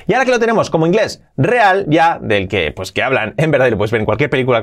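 ordinary delivery

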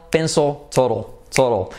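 "pencil, total" ending in swallowed dark Ls; English dark L. Shown as a demonstration. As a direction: let "pencil" and "total" end on a heavy L. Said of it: "esa L inglesa". ("pencil, total" con L inglesa final)

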